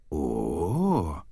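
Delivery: long, low swooping "oooh"; savouring moan. Acted. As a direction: let a low, greedy moan swell up. Shark catches the scent of blood.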